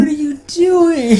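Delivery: very high shocked squeal. Retold or imitated. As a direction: squeal it in horror. Voicing shocked character.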